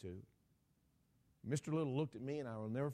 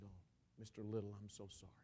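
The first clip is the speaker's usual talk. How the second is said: hushed, lower-pitched sympathy; soft condolence; 6 semitones lower, 8 dB quieter than usual. Retold or imitated. Preacher consoling a bereaved father, hushed sympathy. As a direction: let it drop softly to near nothing.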